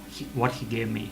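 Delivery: ordinary delivery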